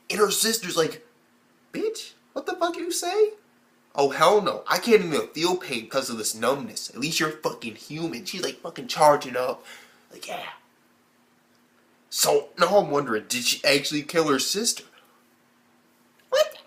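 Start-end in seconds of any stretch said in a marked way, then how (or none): 1.7-3.3 high, incredulous sassy retort; mock indignant comeback. Numb sister answering the pain complaint, mock indignant. 16.3-16.6 extremely high squeaked question; astonished disbelief. Acted. Fan wondering if she killed her sister, astonished squeak.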